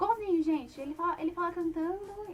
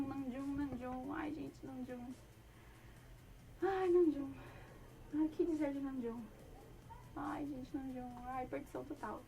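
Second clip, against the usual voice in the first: soft, lovestruck sighing over an idol; swooning; 8 dB quieter than usual. Real, not acted. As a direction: melt quietly at the very name. Fã derretida ao falar de [name]. (suspirando baixinho, derretida)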